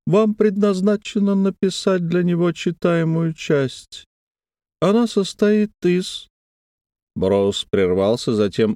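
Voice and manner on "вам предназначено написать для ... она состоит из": a thin eerie wheedle; thin wheedling voice. A narrator's reading. Brose's thin high voice.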